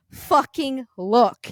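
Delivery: insistent, loud retold order; retold urgent outburst. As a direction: lean hard and slow on each word. Retold snap at husband.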